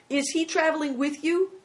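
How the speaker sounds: put-on high-pitched, fearful boy; terrified question. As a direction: press the question in fright.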